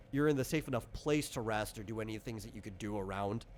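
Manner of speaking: ordinary delivery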